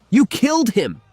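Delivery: high, loud hateful yell; furious. Acted. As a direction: hurl the accusation with hatred. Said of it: "Hatred twisted every word".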